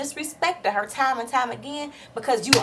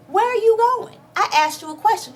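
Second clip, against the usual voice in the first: higher, much louder demand; pretend furious yell; 10 semitones higher, 6 dB louder than usual. Vlogger pretending to stop Apollo leaving, furious yell. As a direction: stop him in his tracks.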